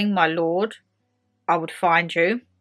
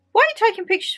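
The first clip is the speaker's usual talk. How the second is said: high, fast snippy question; mocking staff question; 13 semitones higher, much faster than usual. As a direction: fire it off high and quick like a sneering nurse. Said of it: "they was like".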